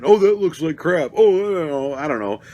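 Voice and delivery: mock the loud fanboys behind you. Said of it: "laughing at everything and talking".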